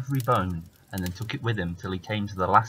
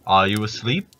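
giant calling out; 7 dB louder than usual. Acted. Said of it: "he called again".

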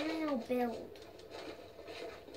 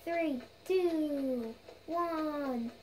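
ordinary delivery

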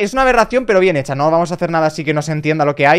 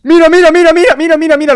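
high, loud rapid calling; excited shout; 13 semitones higher, 10 dB louder than usual. Real, not acted. Gamer calling viewers to look at his find, excited shout. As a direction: call out eagerly to the viewers. Finds the ears item.

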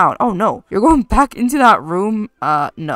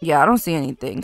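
ordinary talk

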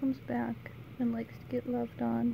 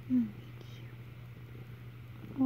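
whispering; 7 dB quieter than usual. Real, not acted. Cooing at the cats.

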